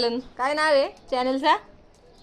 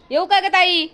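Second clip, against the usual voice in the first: raised voice calling across the house; 6 semitones higher, 5 dB louder than usual. Real, not acted. Calling to her sister while she eats, raised voice. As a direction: call out to her from upstairs.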